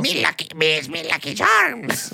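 high, slow mock Irish leprechaun voice; hammed-up Irish accent, like a cereal leprechaun. Comedian doing a bad Irish leprechaun for co-host, hammed-up Irish accent. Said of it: "what accent is that?".

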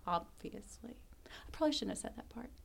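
hushed, nearly whispered aside; sheepish aside. Real, not acted. Podcast host backtracking after oversharing, sheepish and hushed. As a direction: drop to an embarrassed whisper.

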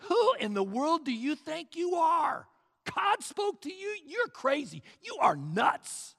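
loud, high-pitched incredulous jeer; scoffing crowd. Acted. Scoffers mocking Noah for saying God spoke, loud jeering. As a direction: hurl it in disbelief like a scoffer. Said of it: "They were all scoffers".

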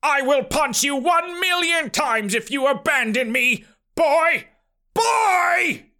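threaten, then cry after the runaway; screaming. A narrator's reading. Uncle screams after the departing boy, screaming. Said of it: "Horbert screamed".

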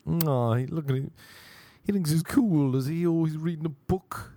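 lightly mock him to the others; mocking onlookers. Onlookers jeering at a man with a book, mocking. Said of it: "And they're like".

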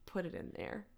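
Delilah voice. Pretend. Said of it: "I'm gonna go Delilah on you".

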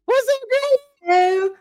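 high loud squeal of welcome; excited greeting. Real, not acted. Host greets guest excitedly.

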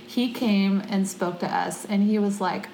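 usual speaking voice

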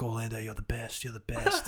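mock fangirl gushing, kept soft; fawning fangirls. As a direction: imitate adoring girls in a small, light voice. Fawning girls voiced in retold story.

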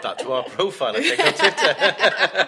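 loud, high, laughing; laughing with delight; excited laughing. Real, not acted. Excited laughing about mum, Twitter.

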